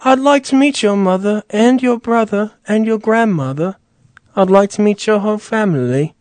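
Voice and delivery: lightly put-on British politeness; Tony asking to meet the family, mock British. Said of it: "He's like".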